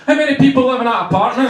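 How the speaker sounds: fast, high, loud mock demand; imitated irate landlord; shouting landlord. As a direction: yell it through a door, quickly.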